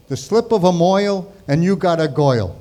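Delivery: broad Brooklynese OY vowels; Brooklyn accent. Retold or imitated. Uncle's favorite saying, in a Brooklyn accent. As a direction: make GIRL rhyme with MOIL, Brooklyn style. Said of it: "He spoke with a Brooklyn accent".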